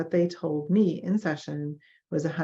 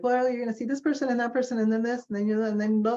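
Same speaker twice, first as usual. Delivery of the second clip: higher, rushed mock patter; rattled-off instructions; 6 semitones higher, faster than usual. Doctor's office staff listing who you'll see, rushed. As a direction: breeze through it like busy staff.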